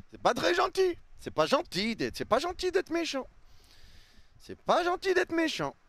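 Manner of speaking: put-on high babyish scolding; childish mock voice. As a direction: whine it like a child.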